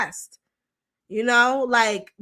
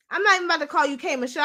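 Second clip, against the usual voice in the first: normal talking voice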